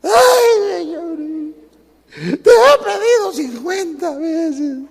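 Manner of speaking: high, loud, drawn-out whining wail; complaining believer wails at God, mock whining; whiny complaining believer. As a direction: whine to God like a spoiled child. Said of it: "ya estamos llorando".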